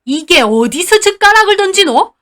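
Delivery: put-on loud, high enraged old woman; enraged grandmother shouting at granddaughter; furious grandma. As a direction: roar at the girl for throwing chopsticks. Grandma raises hand to hit.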